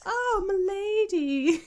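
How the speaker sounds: high mock lady voice; put-on high-pitched lady. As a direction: coo it high, like a man reading a lady's part. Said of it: "like, your lady voice when you do readings".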